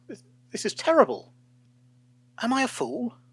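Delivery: high, frantic self-questioning; panicked. A narrator's reading. Paul staring at empty pages, frantic and high. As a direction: spiral into alarm.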